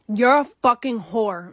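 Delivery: slow venomous accusation, retold; angry insult. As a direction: lay into her, word by word. Girl insults narrator in hallway.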